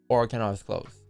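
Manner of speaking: ordinary talk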